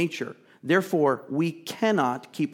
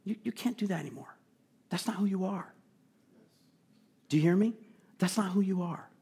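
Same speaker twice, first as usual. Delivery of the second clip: softer, higher, tender plea; pulling us in; 4 semitones higher, 6 dB quieter, faster than usual. Retold or imitated. Apostle pleading with his beloved children, soft and tender. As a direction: draw the children close and plead gently. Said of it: "he's pulling us in".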